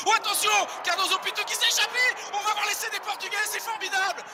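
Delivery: high, fast, thrilled commentary; excited call. Real, not acted. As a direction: shout the breakaway with joy. Breakaway try being called live. (commentaire très excité)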